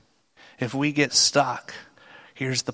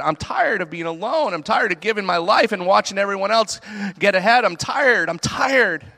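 rising higher and louder to an anguished peak; venting; 7 semitones higher, 6 dB louder than usual. Acted. Elijah venting his exhaustion to God, building louder. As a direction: pour out the frustration, building. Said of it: "Vents to God".

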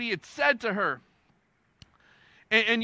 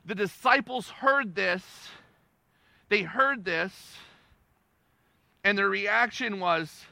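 usual speaking voice

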